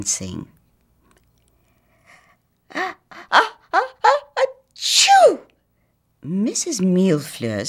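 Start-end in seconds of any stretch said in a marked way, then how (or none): 2.7-5.4 rising ah-ah-ah into a loud achoo; big sneeze. Acted. Old house sneezing awake, a huge high sneeze. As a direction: build the sneeze up high, then let it burst.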